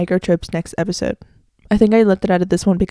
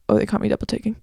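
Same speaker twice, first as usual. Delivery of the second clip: acted sheepish aside; 6 dB quieter, faster than usual. Shown as a demonstration. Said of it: "I'm gonna act it out".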